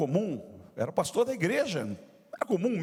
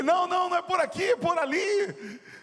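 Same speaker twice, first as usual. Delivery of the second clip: high-pitched, frantic re-enacted call; urgent calling; 14 semitones higher, 3 dB louder than usual. Father chasing his son in the supermarket, urgent mock calling. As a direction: call out to head off a running child. Father steering child from cookies.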